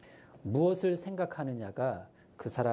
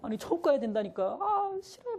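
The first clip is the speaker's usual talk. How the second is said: put-on much higher whiny child protest; crying child. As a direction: whine like a crying first grader. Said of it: "싫다면서 우는 거예요".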